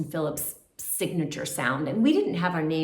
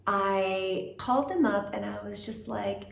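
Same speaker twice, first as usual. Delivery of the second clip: ordinary talk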